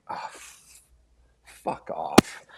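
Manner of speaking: breathy, near-silent mock curse under the breath; muttered curse. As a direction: mutter the swear to yourself, half-voiced.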